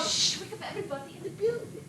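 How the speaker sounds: soft, scolding hush. Acted. Wife hushing husband at four in the morning, softly scolding. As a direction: hush him before the neighbours wake.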